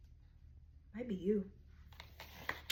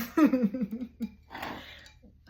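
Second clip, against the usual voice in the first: high laugh burst after a groan; loud laugh; 8 semitones higher, 9 dB louder than usual. Real, not acted. Reader laughing at the Devil card she pulled, loud laugh. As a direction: laugh out in disbelief.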